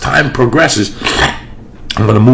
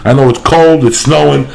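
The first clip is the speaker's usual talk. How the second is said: higher, louder pitchman plugging sponsors and shows; hyped ad read; 5 semitones higher, 3 dB louder than usual. Acted. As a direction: sell it like a radio ad. Reads a sponsor ad and show plugs.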